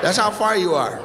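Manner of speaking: usual speaking voice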